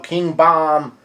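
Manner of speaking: usual speaking voice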